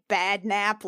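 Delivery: normal talking voice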